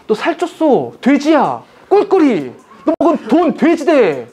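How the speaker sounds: high-pitched, teasing name-calling; mock taunting. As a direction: needle a friend with hammed-up insults, pitched high. Acted taunts in food quarrel. (높은 톤으로 약 올리듯 놀리는 연기)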